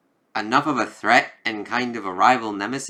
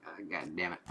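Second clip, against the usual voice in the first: low, much softer grumbled "God damn it"; mock-annoyed low mumble; muttered curse; 5 semitones lower, 18 dB quieter than usual. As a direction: swear under your breath, defeated. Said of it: "like, face of".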